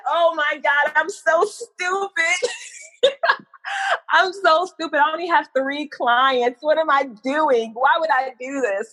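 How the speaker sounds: high, teary replay of a self-scolding sob; retold crying. Alone in the car after walking out, crying. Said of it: "got in the car, and I cried".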